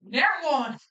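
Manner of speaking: high exclamation over a dice roll; real burst of excitement; excited outcry. Real, not acted. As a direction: call out the bad roll, pitch jumping up. Player calls out a disastrous roll.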